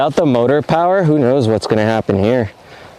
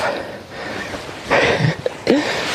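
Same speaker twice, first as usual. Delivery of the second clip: breathy laugh; 11 semitones higher, 6 dB quieter than usual. Real, not acted. Laughs at the shallow hill.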